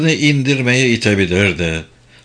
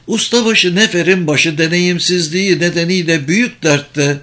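higher-pitched voice for the wife; scheming wife; 6 semitones higher than usual. Acted. Wife telling her husband of their rival's troubles, scheming. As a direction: play the conspiring wife in a raised pitch. Voices Serketa sharing intrigue.

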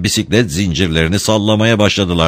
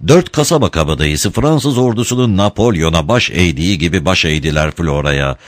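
ordinary delivery, nothing marked

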